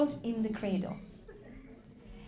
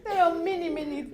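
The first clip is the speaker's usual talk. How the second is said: loud, fervent sermon delivery; impassioned preaching; 4 semitones higher, 8 dB louder than usual. Acted. Preacher voicing Imam and mother at Karbala, loud. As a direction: push every line with grief.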